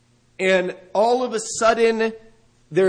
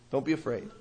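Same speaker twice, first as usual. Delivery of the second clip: soft reassurance; 6 semitones lower, 10 dB quieter, faster than usual. Acted. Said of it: "The first thing the angel says".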